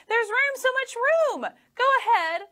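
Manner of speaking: put-on very high, eager boy voice; eager little boy urging on; coaxing boy voice. A narrator's reading. Boy voice in story.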